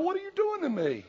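high-pitched mock wail of disbelief; plaintive protest. Preacher at home complaining to God, plaintively aggrieved. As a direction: whine it upward as an aggrieved question.

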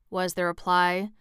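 plain reading voice

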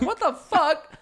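mock shock. Kid shocked at brother.

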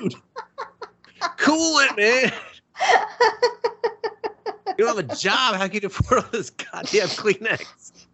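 high, laughing mock scolding. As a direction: scold him while cracking up. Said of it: "I'm like".

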